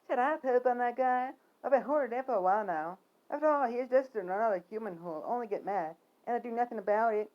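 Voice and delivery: high, put-on bird voice; talking bird. Bird grumbling about a human below, high voice. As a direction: pitch it up like a chattering bird.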